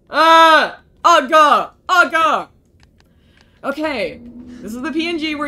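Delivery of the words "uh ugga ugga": loud, high exasperated groans; frustrated grunts. Real, not acted. Gamer venting after failing, loud high groaning bursts.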